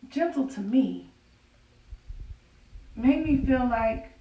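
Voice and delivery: ordinary delivery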